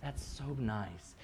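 soft, slow placating reply; understated, indulgent teacher murmur; teacher humoring a child. Acted. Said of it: "And I would go".

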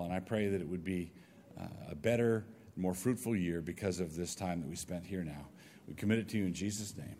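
soft, earnest prayer voice; hushed closing prayer. Real, not acted. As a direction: pray quietly over the students.

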